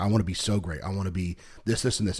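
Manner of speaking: normal talking voice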